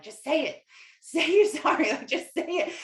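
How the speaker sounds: high, loud, mock nagging voice, pushing herself to say sorry; a hammed-up inner nag; prodding herself to apologize to her husband, high and loud; pushy inner voice.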